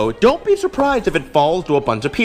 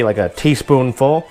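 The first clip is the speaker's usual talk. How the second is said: ordinary talk, nothing marked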